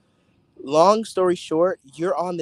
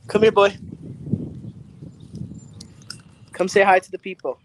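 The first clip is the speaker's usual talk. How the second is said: loud, higher call to the dog; 4 semitones higher, 3 dB louder than usual. Real, not acted. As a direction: call him over across the room. Calling the dog over.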